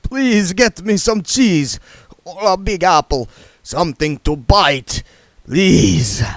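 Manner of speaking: high, slow pleading vampire voice; vampire begging a lady for cheese, high and slow; desperate vampire. Acted. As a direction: beg for food like a starving vampire.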